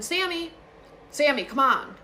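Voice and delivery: high-pitched call to a dog. Retold or imitated. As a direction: imitate a stepdad summoning his dog.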